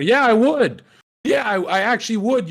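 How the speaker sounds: loud sarcastic agreement, pitched well up; emphatic retort. Real, not acted. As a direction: shout it back, fully agreeing. Fires back at people's advice.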